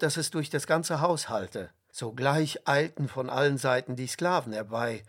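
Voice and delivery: ordinary delivery, nothing marked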